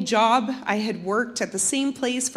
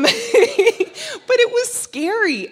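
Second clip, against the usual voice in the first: laughing; 11 semitones higher, 9 dB louder, much slower than usual. Real, not acted. Laughing about the scary flight.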